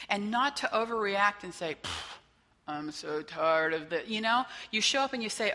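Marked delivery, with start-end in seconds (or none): 1.8-2.2 a dismissive breathy pfft, low and soft; exasperated pfft. Retold or imitated. Counselor imitating a fed-up client, dismissive pfft. Dismissive exhale before cynical quote. 2.7-4.1 lightly voiced weary complaint, slow; mock weary. Counselor mimicking a burned-out group member, mock weary. Imitating a cynical, fed-up client.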